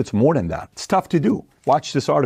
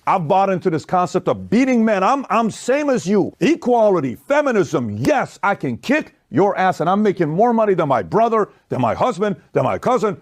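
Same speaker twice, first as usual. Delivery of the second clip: lampoon a smug go-getter bragging she beats the men; bragging career woman; 10 semitones higher than usual. Retold or imitated. Host mocks a wife boasting she out-earns men, high and smug. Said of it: "So imagine you're like".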